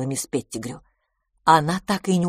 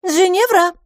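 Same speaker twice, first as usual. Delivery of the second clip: high, loud call from another room; 13 semitones higher, 7 dB louder than usual. A narrator's reading. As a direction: summon her by name, loudly. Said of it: "позвала мисс Лафос". (громкий высокий зов)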